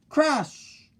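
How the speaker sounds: high, falling Crash!; Crash! called high and falling; crash sound. A narrator's reading. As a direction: belt out Crash! like a sound effect. Sound effect word in story.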